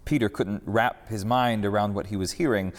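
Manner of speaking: ordinary delivery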